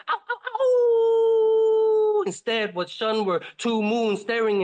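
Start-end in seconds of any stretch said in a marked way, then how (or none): 0.1-2.2 loud, high, drawn-out howls; slow, loud wolf howls to the sky; wolf howling. A narrator's reading. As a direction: throw your head back and howl at the sun. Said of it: "he howled at the sun".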